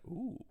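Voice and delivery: a charmed little sigh; mock swooning ooh. Wife charmed by her husband's rudeness, a soft, high swoon. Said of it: "she's like".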